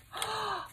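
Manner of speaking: short, high intake of breath; quick astonished gasp; delighted gasp. Real, not acted. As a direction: gasp with joy. Second gasp at the journal.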